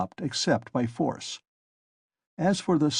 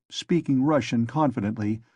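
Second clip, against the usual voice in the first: ordinary delivery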